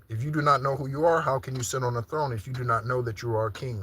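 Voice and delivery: normal talking voice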